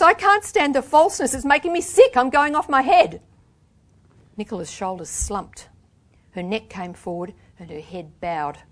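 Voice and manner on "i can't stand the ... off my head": loud, high desperate outburst. A narrator's reading. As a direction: let it climb towards hysteria. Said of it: "I said in a high voice".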